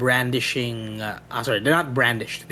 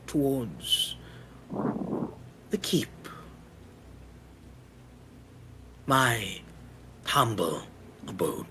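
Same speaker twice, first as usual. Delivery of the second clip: quiet, menacing reading; ominous letter reading; 5 dB quieter than usual. Acted. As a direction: read the letter softly, sinister. GM reads the letter aloud.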